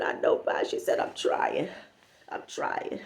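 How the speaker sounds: soft, whispered trying; mock-fragile whisper; upset friend. Friend reassuring Pa, in a soft whisper.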